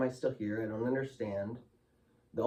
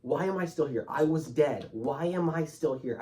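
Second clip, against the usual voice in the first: screaming; 6 semitones higher than usual. Retold or imitated. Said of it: "and I'm screaming".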